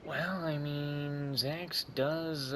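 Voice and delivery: ordinary talk, nothing marked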